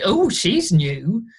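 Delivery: mock a loud voice from the stalls; imitated loud heckler. Audience member commenting on the new actress, drawn-out loud heckle. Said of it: "a very loud voice from the auditorium".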